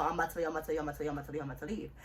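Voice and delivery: low, fading string of assurances; hurried repeated reassurance. Retold or imitated.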